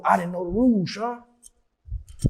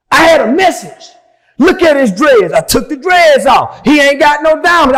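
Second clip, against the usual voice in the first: shouting, higher and louder, building as he goes; real shouting; 8 semitones higher, 9 dB louder than usual. Real, not acted. To the interviewer, shouting how God elevated him. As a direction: let it all out, defiant. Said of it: "Bro broke his chain".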